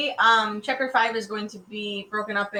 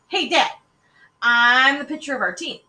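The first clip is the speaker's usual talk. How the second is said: put-on higher, louder boy butting in; eager boy; 6 semitones higher, 9 dB louder than usual. A narrator's reading. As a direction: pipe up loudly to get Dad's attention. Child butting in at dinner.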